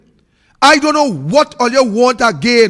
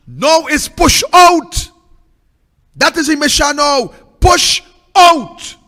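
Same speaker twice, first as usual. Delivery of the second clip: loud, slow, raised chant of push out; rally shout; 5 semitones higher, 5 dB louder, slower than usual. Real, not acted. Protester rousing the crowd against the government, loud chant. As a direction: drive each push out home to the crowd.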